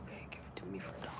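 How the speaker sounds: quick hushed reply; hurried whispered agreement; fast whisper. Retold or imitated. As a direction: whisper it fast, like a secret deal. Whispered retold shop haggle.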